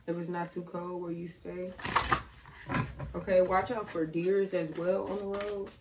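ordinary delivery

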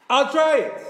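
loud, high, all-out shout; forceful shout. Shown as a demonstration. Preacher showing how the voice must strike, forceful shout. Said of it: "The voice has to strike".

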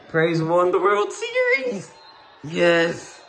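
cheering as the team wins; overjoyed fan yelling the news; excited cheer. Real, not acted. Fan celebrating the championship win.

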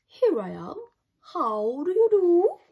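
friendly dragon. Acted. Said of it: "And the dragon said".

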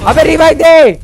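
exasperated demand. Real, not acted. Downed player pestering teammate to revive him, exasperated shout.

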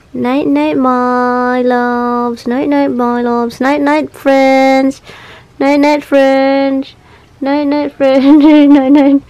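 tender bedtime lilt, ending in a laugh; sing-song goodnight. Real, not acted. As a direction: coo them to sleep, sing-song. Saying goodnight to pets.

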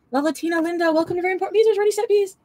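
high, bright sing-song welcome for a new member; channel greeting. Real, not acted. Streamer welcoming a new member to the channel, in a high sing-song greeting. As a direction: announce the newcomer like a cheery host.

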